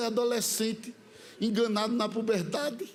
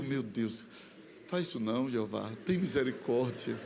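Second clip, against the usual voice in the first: much lower, soft, begging voice; frightened plea; 10 semitones lower, 5 dB quieter than usual. Retold or imitated. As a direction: whimper for mercy under the breath. Terrified pleading to God. (súplica baixa e com medo)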